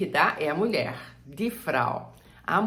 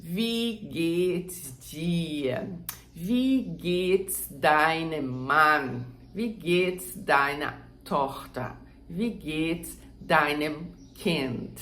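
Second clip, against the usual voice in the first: slow, careful learner's pace; slow and deliberate, as a model for students; much slower than usual. Shown as a demonstration. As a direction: spell each German word out slowly. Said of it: "é falar bem devagarzinho".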